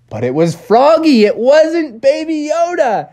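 excited storyteller punchline; dramatic reveal. A narrator's reading. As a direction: hit the twist with mock alarm.

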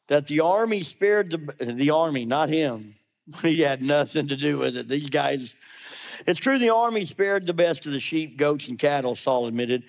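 usual speaking voice

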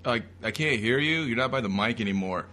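ordinary talk, nothing marked

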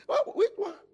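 sudden high startled "wait, what"; shocked wife. Acted. Sarah startled when husband names Isaac, shocked. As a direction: jolt up in disbelief.

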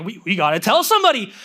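building to high, urgent elation; acted-out jubilant urgency, climbing in pitch; antsy excitement. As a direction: burst out with news you can't hold in. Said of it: "there's an antsiness in you".

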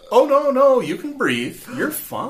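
high, hurried hushing of a panic; high-pitched reassurance. Acted. Dream guide tells Lucy she can breathe, high-pitched reassurance. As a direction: start loud and high, then ease off.

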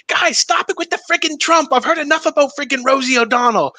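put-on exasperated mom in high-pitched Boston accent. Mom scolds sons over their impression, high Boston accent. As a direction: mimic a fed-up Boston mother scolding. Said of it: "my mom with her Boston accent".

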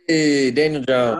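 loud, slow happy callout; excited cheer. Real, not acted. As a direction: cheer the name out. Cheering a correct team pick.

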